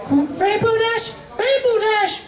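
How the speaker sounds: high gentle pony, anxiously calling out; shaking her friend awake in a high voice; high timid pony voice. Acted. Fluttershy calls fallen friend.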